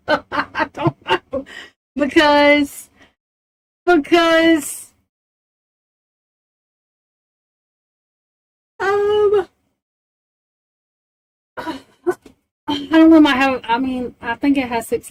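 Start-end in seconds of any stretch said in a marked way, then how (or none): 0.1-1.5 laughing. Real, not acted. Giggling fit while streaming. 2.0-13.2 laughing. Real, not acted. Laughing fit, high pitched.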